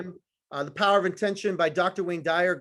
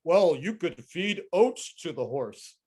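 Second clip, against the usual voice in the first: slow, laughing send-up of a sales guru; mocking quote; 4 semitones lower, slower than usual. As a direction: ham up the advice as a joke. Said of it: "Tom Hopkins says".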